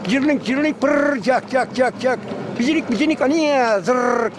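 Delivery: high chirps with rolled trills; trilling bird imitation. Shown as a demonstration. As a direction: imitate an excited songbird, pitched well up. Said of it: "Bunlar lezzetli kuşlar. Şimdi". (tiz, titretmeli kuş taklidi)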